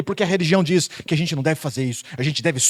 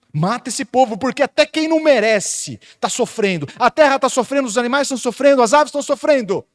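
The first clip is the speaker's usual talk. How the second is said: higher and louder, building urgency; loud preaching; 8 semitones higher, 6 dB louder than usual. Acted. Preacher voicing Jeremiah crying to the Lord, full-force preaching. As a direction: plead with the congregation for the suffering land.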